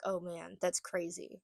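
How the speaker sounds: soft, low-key acknowledgement; understated reaction. Retold or imitated. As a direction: react quietly, without any fuss. Low-key reaction to info dump.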